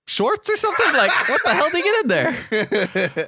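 high, baffled what the fuck. Retold or imitated. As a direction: sound totally confused. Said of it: "I was like".

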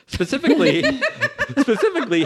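breaking into high laughter on the word specifically; can't get through it for laughing; laughing mid-read. Real, not acted. Reading the reporter's prank text to cohosts, laughing through it.

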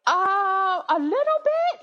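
very high, squeaky guilty answer; sheepish fib. Retold or imitated. Wife fibbing to husband about transcribing, high sheepish squeak. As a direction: squeak it out as an obvious mock fib.

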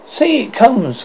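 high-pitched call to look; crying out. A narrator's reading. Curly points out Wendy in the sky, crying out. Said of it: "cried Curly".

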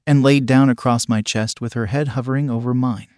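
plain reading voice

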